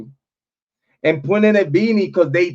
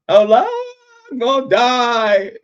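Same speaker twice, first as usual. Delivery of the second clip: mock dying wail; 8 semitones higher than usual. Man re-enacting thinking he was dying, mock wailing.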